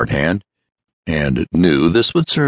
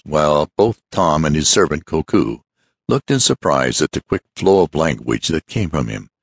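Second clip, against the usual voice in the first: usual speaking voice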